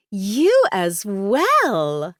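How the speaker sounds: very slow, high swooping flirty voice; flirtatious Sky, delighted. Acted. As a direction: stretch it out coyly and loudly. Sky flirting with Sean in story.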